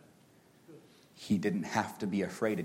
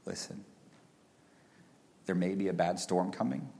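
soft, low fatherly reassurance; soft comfort to a frightened four-year-old; comforting his son; 3 semitones lower, 6 dB quieter than usual. Retold or imitated. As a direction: hush a scared child on your lap. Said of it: "I got him on my lap and said".

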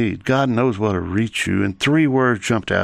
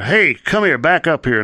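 mock guard's holler, pitched up; retold holler; 6 semitones higher than usual. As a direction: call out like a guard hollering into a cell. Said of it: "holler in there".

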